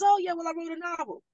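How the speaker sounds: quick offhand imitation, pitched high; matter-of-fact. Teacher casually revealing her secret to the class leader, matter-of-fact. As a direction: drop it casually, as if it's nothing. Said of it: "just a matter of factly".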